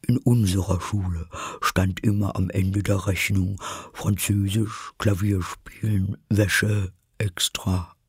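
halting, doleful turtle voice; doleful Mock Turtle. Mock Turtle recalls school in the sea, halting and doleful.

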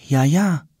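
a hint of a nervous kid; lightly voiced boy Tom. Tom warily eyeing the Nile for crocodiles.

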